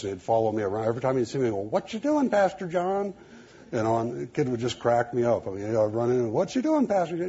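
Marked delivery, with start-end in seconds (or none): 1.6-3.1 put-on high, sing-song little boy; high sing-song child's question; sing-song kid voice. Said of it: "he had this little sing-song voice". 6.3-7.3 put-on high, sing-song little boy; high sing-song child's question; sing-song kid voice. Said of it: "he had this little sing-song voice".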